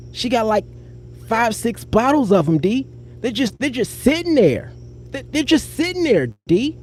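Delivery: high, light mimicry of his girlfriend's voice; imitating Sarah's way of talking. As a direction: playfully imitate how she talks, pitched high. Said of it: "this is how she talk".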